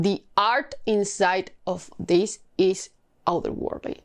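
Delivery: ordinary talk, nothing marked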